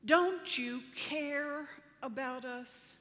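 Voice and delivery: slow, drawn-out, hurt plea; pleading. Retold or imitated. Disciples pleading with Jesus in the storm, slow hurt plea. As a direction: stretch each word like a wounded question. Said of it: "They shouted at him".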